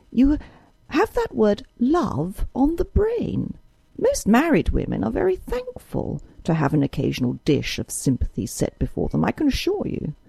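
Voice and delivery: aunt scolding her niece, mildly voiced; pettish. A narrator's reading. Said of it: "said Missus Moore pettishly".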